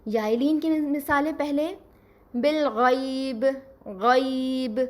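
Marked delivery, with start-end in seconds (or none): 2.3-4.8 غیب with a clear ai glide, slow; غیب read the Arabic way with ai; Arabic pronunciation. Shown as a demonstration. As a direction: say بالغیب as bil-ghaib, drawn out. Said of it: "عربی لب لہجے میں پڑھا جائے گا".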